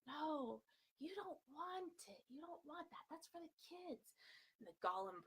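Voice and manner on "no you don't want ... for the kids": high, soft pleading Smeagol, higher; hammed-up Smeagol, higher and softer. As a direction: coax gently like timid Smeagol. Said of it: "the Smeagol brain would be like".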